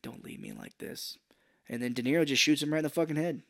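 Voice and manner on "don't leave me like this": much lower, voiceless and faint; soft low dying plea; dying whisper. Retold or imitated. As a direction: plead with your last breath, whispered. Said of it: "He's like".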